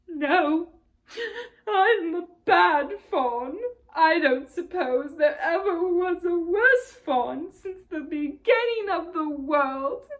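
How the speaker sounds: loud, slow sobbing lament. A narrator's reading. Tumnus sobbing to Lucy about being bad. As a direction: wail it through tears. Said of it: "between his sobs".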